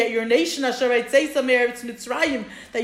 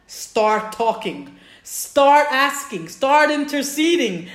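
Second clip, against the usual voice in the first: slow, louder, coaxing urge; urging hint; 3 semitones higher, 6 dB louder, slower than usual. Retold or imitated. God nudging Moshe to speak up, slow and coaxing. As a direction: draw the words out to nudge him.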